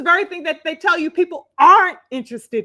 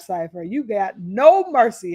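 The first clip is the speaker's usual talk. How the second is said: ordinary talk, nothing marked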